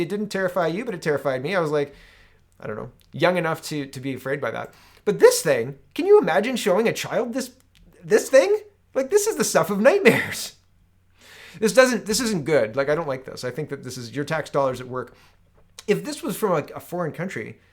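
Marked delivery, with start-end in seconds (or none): 5.1-12.7 much higher and louder, appalled; incredulous outrage. Real, not acted. Host showing viewers the mascot, loud appalled disbelief. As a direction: rise up in disbelief.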